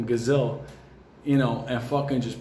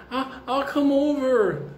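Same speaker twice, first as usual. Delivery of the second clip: very high slow prim lady voice; mock ski lady; 11 semitones higher, slower than usual. Lady offering to walk out, high slow prim voice. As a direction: drawl it sweetly like the skier lady. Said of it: "lady voiced".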